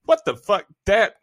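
fed-up, irritated swearing; pissed off. Real, not acted. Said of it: "that pissed me off".

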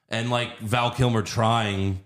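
ordinary talk, nothing marked

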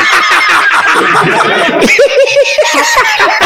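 drawn-out laugh at own joke; long laugh. Real, not acted. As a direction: keep laughing.

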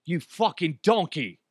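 lightly voiced insulting TV chef; a mild mock celebrity-chef put-down; Gordon Ramsay. Cook at home imagining the chef insulting him, lightly mocking. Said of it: "Gordon Ramsay saying".

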